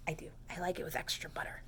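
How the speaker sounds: quick whispered secret; whispered aside. Real, not acted. Reader confides her popcorn taste in a whisper. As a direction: rush it out in a whisper.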